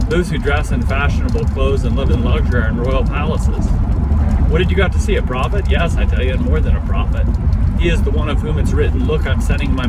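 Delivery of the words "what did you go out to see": plain reading voice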